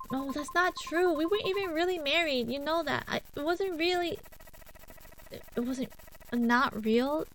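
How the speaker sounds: high, flustered pleading; defensive protest. Acted. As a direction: talk fast to justify yourself. Denying the ghost's accusation.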